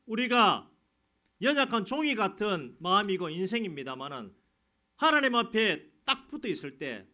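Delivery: high, loud fervent sermon delivery; preaching at full force. Real, not acted. Pastor exhorting believers to be bold, loud and fervent. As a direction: thunder the promise to the congregation.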